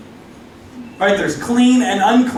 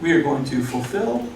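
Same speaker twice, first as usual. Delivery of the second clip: low, soft, self-righteous; prim and proper; 4 semitones lower, 7 dB quieter than usual. Retold or imitated. The nine lepers to the Samaritan, primly lowered. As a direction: say it piously, voice dropping.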